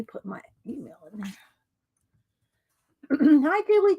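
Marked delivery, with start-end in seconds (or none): 0.0-1.3 a breathless whisper to herself; quick private whisper under the breath; whispered aside. Real, not acted. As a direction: whisper it, mostly to yourself. Aside to herself while writing.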